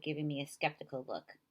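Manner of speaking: ordinary delivery, nothing marked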